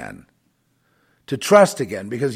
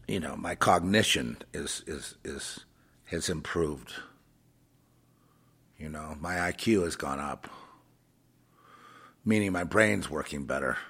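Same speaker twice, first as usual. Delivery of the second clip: ordinary talk, nothing marked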